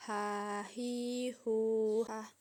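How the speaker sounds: "Ha, hi, hu" drawn out, h made in mid-throat; "ha" ending in an audible throat h. Shown as a demonstration. Teacher shows Arabic ha, "Ha, hi, hu, ha" from mid-throat. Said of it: "dikeluarkan dari tengah tenggorokan".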